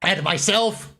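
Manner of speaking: high-pitched, exasperated rant; worked-up fan complaining; annoyed. Real, not acted. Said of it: "I'm just annoyed".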